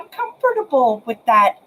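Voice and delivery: ordinary talk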